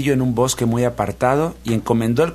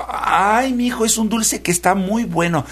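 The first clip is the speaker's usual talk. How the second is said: put-on high, fretful old nanny voice; worried nanny; 10 semitones higher than usual. A narrator's reading. Worried nanny answering the prince about love, high and fretful. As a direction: fuss over the boy nervously. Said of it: "toda preocupada".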